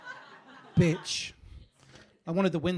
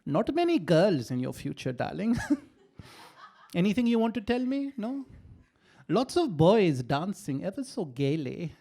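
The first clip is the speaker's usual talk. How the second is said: passive-aggressive nan; 4 dB louder than usual. Retold or imitated. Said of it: "passive-aggressive tea leaf reading".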